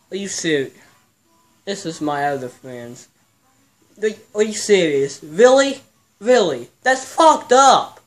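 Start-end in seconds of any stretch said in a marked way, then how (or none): 4.0-8.0 can't believe they pranked you; outraged disbelief. Real, not acted. Learns it was a prank.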